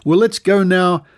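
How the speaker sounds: usual speaking voice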